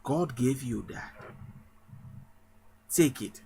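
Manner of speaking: ordinary talk, nothing marked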